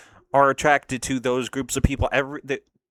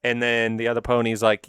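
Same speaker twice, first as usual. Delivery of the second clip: ordinary talk, nothing marked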